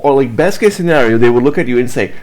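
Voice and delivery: normal talking voice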